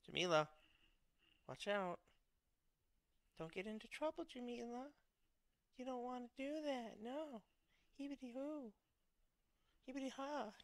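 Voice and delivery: high-pitched, playful caution; warning call. Real, not acted. Warning Jamila not to get into trouble, high sing-out caution.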